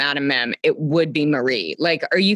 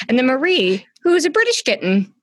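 pitched way up to underline the absurdity; high incredulous emphasis; 8 semitones higher, faster than usual. Real, not acted. Friend to cohost on Marie's accent, high and amused. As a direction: rise high and quick, amazed at the mismatch.

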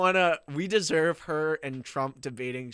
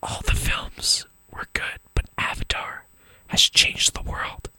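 breathy whisper close to the mic; giggly prank whisper. Acted. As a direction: whisper it like a mock guru. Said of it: "just whisper into the mic".

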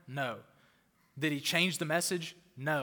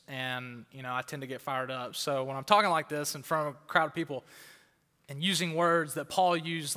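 ordinary delivery

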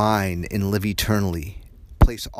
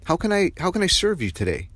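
high, gentle offer of help; caring questions; 10 semitones higher, faster than usual. Shown as a demonstration. Modelling questions to a coworker, high and caring. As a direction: ask in a much higher, solicitous voice.

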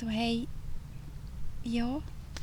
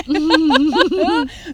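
big loud laugh breaking out; loud burst of laughter; 5 semitones higher, 13 dB louder than usual. Real, not acted. Laughing hard while describing her inner beast.

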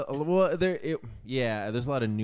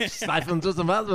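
higher, laughing fake German; mock German; 6 semitones higher, 5 dB louder than usual. Imitating the German guy to the kids, in laughing mock German. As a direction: garble it in a joking German accent. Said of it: "And he's like".

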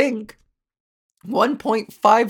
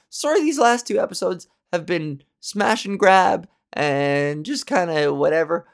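ordinary talk, nothing marked

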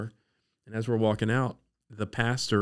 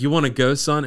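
lively retold invitation, higher and louder; bubbly, optimistic dad; 5 semitones higher, 8 dB louder than usual. Dad invites his son along to the grocery store, bubbly. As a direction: imitate an upbeat dad. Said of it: "bubbly and optimistic".

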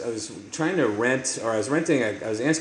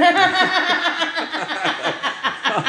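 loud burst of laughter; 4 dB louder than usual. Real, not acted.

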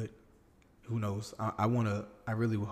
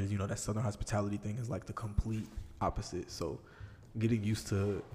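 ordinary talk

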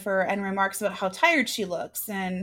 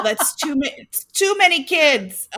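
slow, louder exasperated groan; 5 semitones higher, 4 dB louder, slower than usual. Real, not acted. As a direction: drag it out in disbelief. Repeats her complaint, drawn out.

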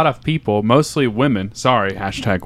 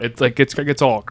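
ordinary talk, nothing marked

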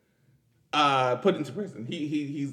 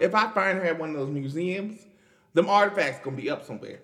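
ordinary talk

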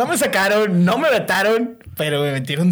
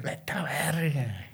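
hushed awe; 2 semitones lower, 10 dB quieter, much slower than usual. Real, not acted. Awed reaction to the huge bet.

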